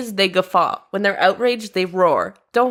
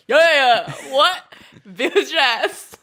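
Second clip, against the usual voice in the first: hype man; 5 semitones higher, slower than usual. Pretend. Guy in the back row hyping the pirate song, higher ad-lib shouts. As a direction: punch out short ad-lib shouts behind the singer. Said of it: "the guy in the back row going".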